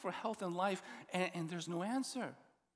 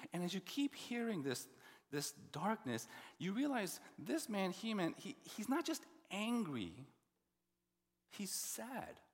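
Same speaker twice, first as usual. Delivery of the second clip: ordinary talk, nothing marked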